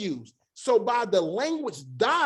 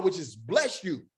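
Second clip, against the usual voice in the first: ordinary talk, nothing marked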